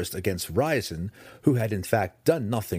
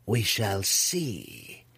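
very slow, silky purr; smug, drawn-out purr; much slower than usual. Acted. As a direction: purr it, stretching each word. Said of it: "Malice purred".

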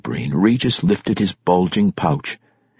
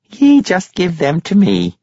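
barkeep woman voice; 11 semitones higher, 5 dB louder than usual. Acted. Barkeep coaxing boy.

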